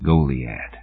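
soft, low, dry near-whisper; a haunted veteran naming the place. A narrator's reading. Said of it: "The voice gone to a dry whisper".

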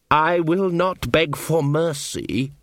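slow, otherworldly elf, each word dragged out; cold elf voice. A narrator's reading. Captured elf refuses Magrat, slow and cold. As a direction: refuse to beg, slowly and coldly.

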